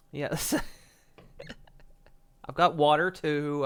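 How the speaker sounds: usual speaking voice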